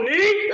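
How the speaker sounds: high loud laugh; burst of laughter. Real, not acted. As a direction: crack up out loud. Laughing at quote.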